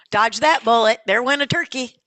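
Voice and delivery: loud, high-pitched good riddance; punchy, loud dismissal; relieved exclamation. Real, not acted. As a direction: crow over a narrow escape. Exclaims over candidate who hung up.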